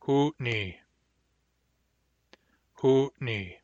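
hard, glottalized n in "Hootney". Shown as a demonstration. Teacher demonstrating hard N to learners, glottalized "Hootney". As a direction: say "Hootney" with a catch in the throat before the n. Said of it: "Hard N".

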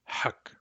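hak said alone with a back H, soft; isolated syllable. Shown as a demonstration. Teacher isolating a syllable, quietly. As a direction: give hak its own breath. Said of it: "third syllable really is hak".

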